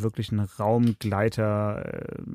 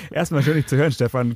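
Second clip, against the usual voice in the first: normal talking voice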